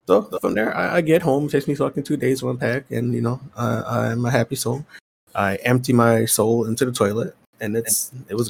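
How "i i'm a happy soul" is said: ordinary talk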